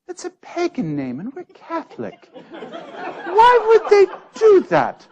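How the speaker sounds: put-on old demented doctor. Old Scottish doctor muses about his name, high and bemused. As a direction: wonder aloud like a confused old man. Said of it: "every conversation ... would start".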